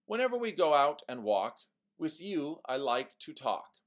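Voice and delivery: forced rhyme read. Shown as a demonstration. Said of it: "Here's another one".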